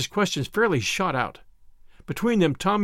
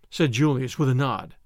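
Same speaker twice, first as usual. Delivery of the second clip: plain reading voice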